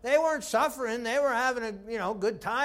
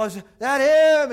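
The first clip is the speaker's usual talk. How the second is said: loud, drawn-out eager cry; higher, stretched excited question; excited crowd's question; 4 semitones higher, 12 dB louder than usual. Retold or imitated. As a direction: call it out in eager hope. Crowd eagerly asks at gates.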